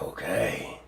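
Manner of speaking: low, very soft villain warning; deep, hushed threat from a creature sure of itself; menacing octopus. Acted. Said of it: "He's like".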